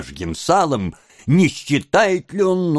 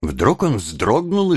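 ordinary delivery

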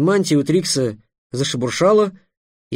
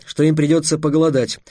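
ordinary delivery, nothing marked

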